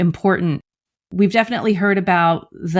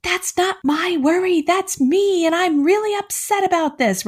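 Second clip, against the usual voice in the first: put-on much higher, childlike protesting voice; imitating upset kid; 11 semitones higher than usual. Upset kid telling parent it's not worry, in a higher childlike voice. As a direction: play the kid pushing back. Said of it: "the kids who say".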